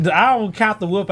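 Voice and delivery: ordinary talk, nothing marked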